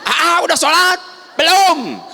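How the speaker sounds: high, loud, shrill exchange; mother and child yelling back and forth; volume at nine and a half. Retold or imitated. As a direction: shout it at full volume. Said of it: "langsung salapan setengah".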